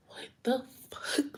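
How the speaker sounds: hushed, drawn-out disbelief; stunned whisper. Retold or imitated. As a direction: whisper it slowly, utterly baffled. Stunned reaction on waking up.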